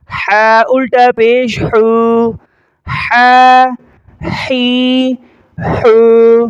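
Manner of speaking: slow drill, "ہا، حی، حو" rasped from the throat and held level. Shown as a demonstration. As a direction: tighten the throat on h in "ہا", stretch the vowel. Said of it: "حلق سے آواز نکالیں گے". ("ہا" حلق سے، کھینچ کر)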